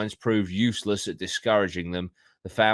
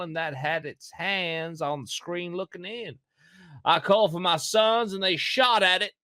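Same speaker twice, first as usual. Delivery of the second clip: American accent; 10 semitones higher than usual. Shown as a demonstration. Reading the witness statement aloud in a put-on Kentucky accent. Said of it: "read it in an American accent".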